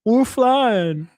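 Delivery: put-on lifting the girlfriend overhead, high silly voice; playful giggling. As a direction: giggle like a kid being spun around. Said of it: "I'm like".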